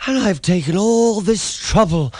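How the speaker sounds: high loud indignant cry; very high upset complaint; near shriek. A narrator's reading. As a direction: shriek in outrage. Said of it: "he almost shrieked".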